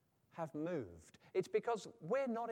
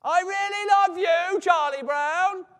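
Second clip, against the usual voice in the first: full-volume, high, drawn-out shout; Lucy shouting; 13 semitones higher, 18 dB louder, slower than usual. Acted. Lucy insisting to Charlie Brown, absolutely shouting. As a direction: bellow every word slowly, all in capital letters. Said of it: "she's absolutely shouting it".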